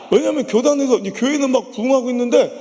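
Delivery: normal talking voice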